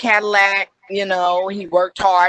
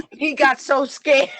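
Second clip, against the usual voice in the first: laughing through the words, higher; 7 semitones higher than usual. Real, not acted. Recalling the scared trooper, laughing. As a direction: giggle as you tell it.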